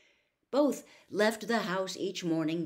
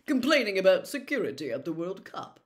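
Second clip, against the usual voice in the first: loud, self-important official; pompous Percy; 4 semitones higher, 8 dB louder than usual. A narrator's reading. Percy boasting to the family about his busy week, pompous. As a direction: boast of your hard work, puffed up.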